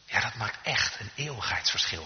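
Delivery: settled falling statement; firm answer. Real, not acted. Preacher answering doubters about eternal difference, firm. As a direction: affirm it soberly, weight on "echt".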